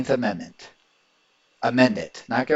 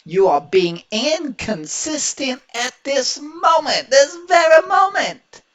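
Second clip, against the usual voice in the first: builds from slow and higher to a full high shout; rising angry outburst; 10 semitones higher, 5 dB louder than usual. Real, not acted. Commentator scolding the congresswoman for inconsistency, slow build to angry shout. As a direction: scold her, swelling into a yell on this very moment.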